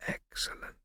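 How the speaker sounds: soft breathy whisper; whispered very quietly. A narrator's reading. Scipio whispering to Prosper, very quietly. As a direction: barely whisper his name.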